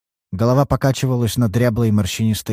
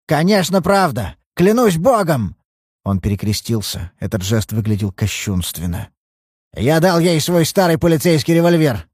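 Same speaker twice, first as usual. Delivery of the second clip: put-on emphatic old father; 6 semitones higher than usual. Old father swearing to the detective he gave her the gun. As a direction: insist fervently.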